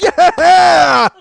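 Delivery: very high, loud whoop; elated shout. Retold or imitated. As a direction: explode into a triumphant cheer. Reenacts cheering after hitting table.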